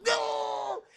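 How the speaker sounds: high, loud drawn-out wail; imitated freak-out cry. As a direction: cry out as the drug hits. Imitating Jordana's reaction to inhaling.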